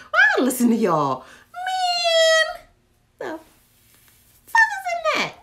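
loud, high, laughing yell; laughing outcry. Real, not acted. Reacting to viewers' catnip candle pick, loud laughing yell. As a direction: cry out in laughing disgust.

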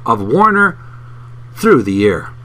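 animated book reading; reading aloud. Acted. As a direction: read the passage like an engaged narrator. Reads book passage aloud.

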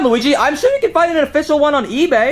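ordinary delivery

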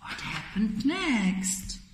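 plain reading voice

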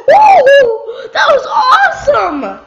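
gridato di gioia; a joyful, high shout of victory; excited whoop. Real, not acted. Thrilled after a kill.

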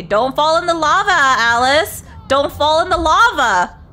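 urgent warning. Real, not acted. Player warning herself off the lava, urgent warning. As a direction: call it out high and loud, urging yourself on. Warns self not to fall.

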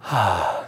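low satisfied ah; a deep, contented sigh of approval; God's low, pleased ah. Retold or imitated. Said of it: "he sees you do this and he goes".

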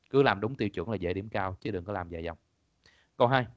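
normal talking voice

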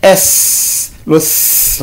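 S hissed out unvoiced as a sound; teacher hisses S for the class. Shown as a demonstration. Teacher demonstrates the S sound to pupils, hissed. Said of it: "quand je dis ici".

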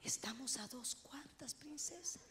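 husband whispers to calm his excited wife; hushing whisper. Retold or imitated. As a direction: shush her gently, barely a breath. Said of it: "Entonces él me hace así".

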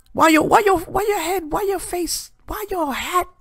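high-pitched roast of the caller's head; mocking high voice. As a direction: jump way up and taunt him.

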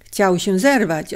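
usual speaking voice

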